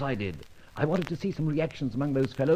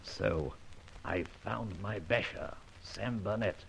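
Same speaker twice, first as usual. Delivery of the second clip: quiet musing; 7 semitones lower, 14 dB quieter, slower than usual. Acted. Detective muses alone afterwards.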